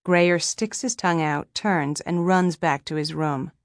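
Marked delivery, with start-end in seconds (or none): none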